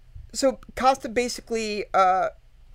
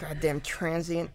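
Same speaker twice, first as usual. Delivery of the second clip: mock grumble; 5 semitones lower, 7 dB quieter than usual. Mock insult voice.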